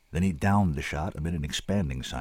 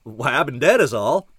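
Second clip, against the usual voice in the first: louder, relishing the reveal; enjoying himself hugely; 3 semitones higher, 5 dB louder than usual. A narrator's reading. Chuck stuns the kid, enjoying himself hugely. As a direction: drop the bombshell with relish. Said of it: "enjoying himself hugely".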